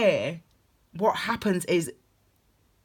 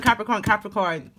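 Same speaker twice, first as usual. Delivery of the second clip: ordinary delivery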